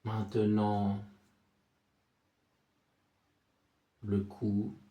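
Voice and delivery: soft, low meditation voice with long silences; guided body scan. Acted. Sophrology guide takes listener from forehead to chest, soothing guided relaxation.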